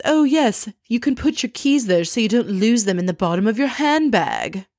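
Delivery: mock-helpful man butting in, starting high; obnoxious know-it-all. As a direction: imitate an ass chiming in uninvited. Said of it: "just like an ass, he chimes in".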